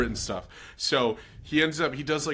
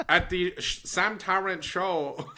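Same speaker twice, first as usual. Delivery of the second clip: slow, high mock Chinese accent; 5 semitones higher than usual. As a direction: drag out the Chinese accent, laughing. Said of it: "Your Chinese came out".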